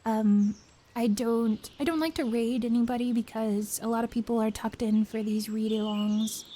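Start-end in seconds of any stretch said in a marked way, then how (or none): none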